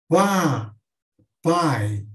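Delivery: "Pá, pai" with a loud A falling into a weak I; ditongo decrescente. Shown as a demonstration. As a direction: punch the A in "Pá", then add the fading I in "pai". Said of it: "é uma vogal forte e uma semivogal mais fraca". ("Pá, pai" com A forte e I fraco)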